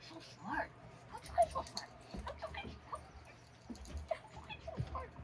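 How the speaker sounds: very high cooing praise; squeaky praising a dog. Real, not acted. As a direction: coo at the puppy. Praising puppy.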